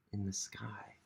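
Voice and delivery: hushed sky ending; whisper. A narrator's reading. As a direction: trail off in a whisper.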